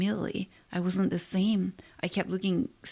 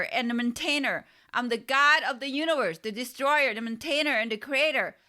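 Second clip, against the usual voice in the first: louder proclaiming shout; 7 semitones higher than usual. A narrator's reading. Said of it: "Then I shouted much louder".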